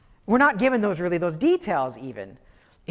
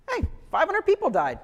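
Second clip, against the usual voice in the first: much higher, offhand, rising louder at the end; nonchalant king; 10 semitones higher, 4 dB louder than usual. Retold or imitated. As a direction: breeze past the deaths. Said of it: "he seems very nonchalant".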